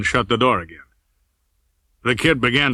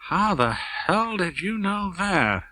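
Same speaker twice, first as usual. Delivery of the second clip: a quiet, slow question with surprise held back; 7 semitones higher, 6 dB quieter, slower than usual. A narrator's reading. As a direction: ask it under your breath, slowly. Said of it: "Olds asked quietly".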